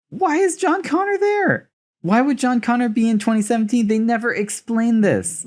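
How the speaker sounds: high-pitched, baffled complaint; exasperated disbelief. Real, not acted. Podcaster questioning the film's plot, high and incredulous.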